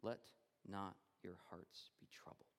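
Jesus comforting his frightened disciples in a whisper; loving whisper. A narrator's reading. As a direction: breathe it to them tenderly. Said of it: "lovingly says".

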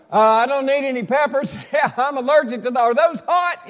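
loud, high voice carrying across a hotel; real loud talking. Acted. Husband ordering an omelet heard floors above, real loud. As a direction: call out the order far too loudly. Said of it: "I'm just talking real loud".

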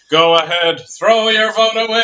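loud, high cartoon taunt; big put-on dismissive jeer; Simpsons quote. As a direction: declaim it like a gloating cartoon character. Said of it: "remember The Simpsons".

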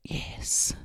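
unvoiced breathy whisper; right in your ear. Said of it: "you'd say".

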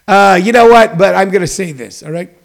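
ordinary talk, nothing marked

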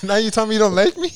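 fast, high, loud hammed-up whine of hurt pride; mock offended rant. Retelling the killer's grievance to friends, fast hammed-up whine. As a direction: fire it off like a scorned hostess. Repeating the killer quote, laughing.

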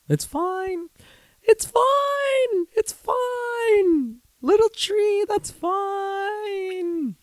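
very high, strained falsetto squeal; squeaky fake acceptance. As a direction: insist you're okay in a squeaky falsetto. Feigned acceptance, squeaky meltdown.

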